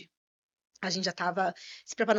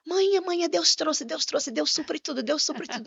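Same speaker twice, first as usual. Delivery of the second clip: put-on higher-pitched, thrilled child's outburst; excited child; 6 semitones higher, slower than usual. Son wakes to find the apples and calls his mother, excitedly. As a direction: imitate an overjoyed kid, words tumbling out repeatedly. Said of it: "Mas desse jeito".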